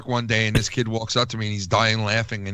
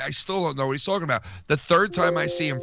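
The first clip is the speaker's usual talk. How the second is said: ordinary talk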